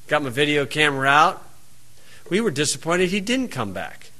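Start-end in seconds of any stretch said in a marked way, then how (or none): none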